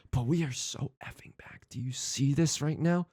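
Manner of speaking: stage whisper. Real, not acted. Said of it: "I'm doing a stage whisper".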